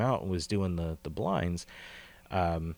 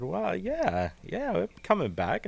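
surprised reply; 12 semitones higher, slower than usual. Retold or imitated. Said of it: "I said".

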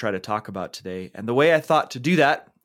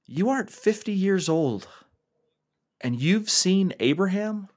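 much higher, disbelieving question; incredulous teachers; 9 semitones higher than usual. Acted. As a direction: scoff at an absurd claim. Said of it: "The teachers replied".

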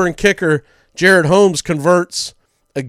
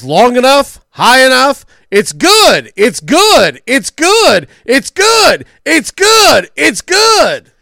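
excited radio play-by-play shout, climbing higher with each repeat; Jim Fife's famous call; 8 semitones higher, 6 dB louder, slower than usual. Retold or imitated. Radio announcer calling the winning field goal, excited rising shout. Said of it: "his famous call was".

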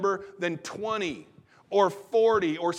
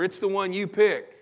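ordinary delivery

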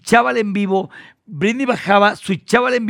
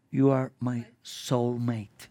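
tender confession; 8 semitones lower, 12 dB quieter, much slower than usual. Retold or imitated. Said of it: "y me dice".

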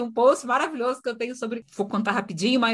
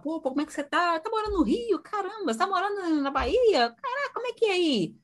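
much higher, lively friendly small talk; chatty icebreaker; 11 semitones higher than usual. Retold or imitated. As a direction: break the ice like chatting with an old friend. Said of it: "e eu sempre pergunto assim". (mentora puxando papo, animada e bem aguda)

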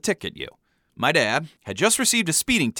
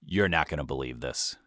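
low, soft, sheepish admission; Brendan from the back; 10 semitones lower, 8 dB quieter than usual. A narrator's reading. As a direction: drop it quietly from the back seat. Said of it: "we heard Brendan say from the back".

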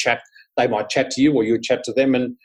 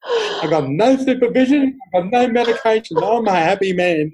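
gleeful pretend madman; 11 semitones higher, 4 dB louder than usual. Jokester playing crazy seatmate to alarmed passenger, gleeful. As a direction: play the happy loony, breaking up.

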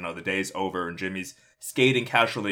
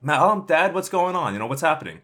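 high, louder anxious call to his parents; a scared kid pushing through the crowd; panicked son asking his parents; alarmed teen; 9 semitones higher, 6 dB louder than usual. Acted. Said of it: "concerned to see what's happening".

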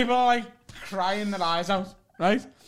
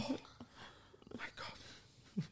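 breathless whisper, much softer; whispered oh my God; 4 semitones higher, 21 dB quieter, slower than usual. Retold or imitated. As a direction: hush it out in shock. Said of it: "he's like".

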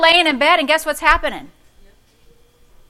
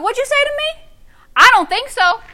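much higher, sassy challenge; 11 semitones higher, 4 dB louder than usual. Pretend. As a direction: talk back sassy to the devil. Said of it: "you gotta get sassy".

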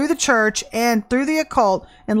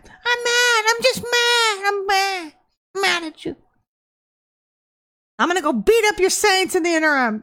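much higher, whiny mock child throwing a fit; childish tantrum; 10 semitones higher than usual. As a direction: mimic a sulking teenager stamping her feet. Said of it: "acting like fifteen-year-olds".

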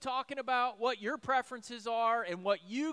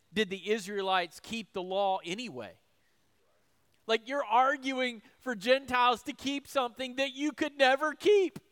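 ordinary talk, nothing marked

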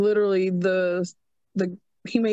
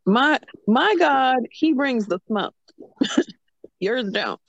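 trash-talk the rival gods, half laughing; taunting; 6 semitones higher, 4 dB louder than usual. Retold or imitated. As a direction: playfully imitate a prophet taunting his rivals. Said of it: "pretty much taunting them".

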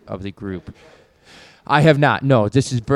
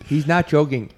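ordinary talk

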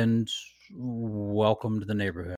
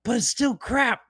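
excited bartender; 13 semitones higher, 7 dB louder than usual. Retold or imitated. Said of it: "He was like".

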